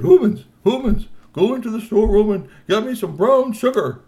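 very high, loud commanding troll voice; bossy troll sister. Acted. Fidget sends the humans for brown sugar, high and loud. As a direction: bark orders like a shrill troll boss.

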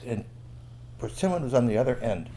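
plain reading voice

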